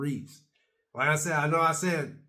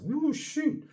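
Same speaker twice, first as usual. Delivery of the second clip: dismayed ooh; 12 semitones higher than usual. Retold or imitated. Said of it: "And I go like this".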